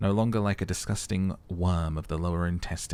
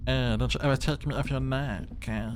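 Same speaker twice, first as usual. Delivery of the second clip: higher put-on tie voice, half threatening; clingy necktie refusing to be swapped; possessive talking necktie; 6 semitones higher than usual. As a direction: warn like a jealous tie. Necktie voiced warning player.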